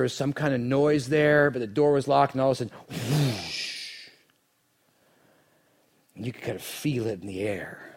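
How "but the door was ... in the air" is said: usual speaking voice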